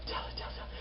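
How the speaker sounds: breath-only whispered urging; urgent whisper. Retold or imitated. Viewer urging a character to confess, whispered. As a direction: whisper it in quick repeats, willing her on.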